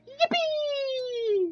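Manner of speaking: very high squeaky Mario yippee. Retold or imitated. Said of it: "The one when he goes".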